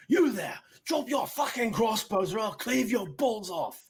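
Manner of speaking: high, forceful shouted order; commanding threat. Acted. Sir Rory ordering bandits to drop crossbows, threatening shout. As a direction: roar a threat across the battlefield.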